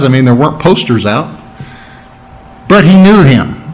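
ordinary talk, nothing marked

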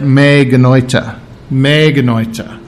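me genoita said slowly, in Greek, as me geh-NOY-tah; me genoita said twice, read as a Greek term; Greek phrase. Shown as a demonstration. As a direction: say me genoita carefully as Greek, low and deliberate. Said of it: "in the original language, it's two words".

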